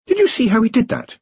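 put-on high, eager young wizard; Ponder voice. A narrator's reading. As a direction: pitch it up like a nervous student. Ponder asks, astonished.

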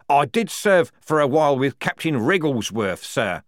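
high, loud interjection; interrupting. Acted. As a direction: cut in eagerly over a superior. Strappy interrupts general.

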